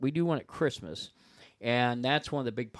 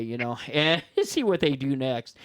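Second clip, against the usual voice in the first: normal talking voice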